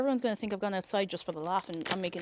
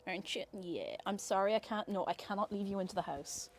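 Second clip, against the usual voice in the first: ordinary talk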